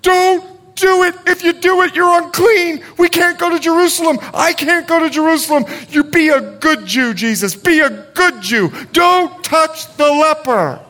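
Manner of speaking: a scared friend pleading with Jesus not to touch; frantic Peter pleading. Retold or imitated. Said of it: "and Peter's going".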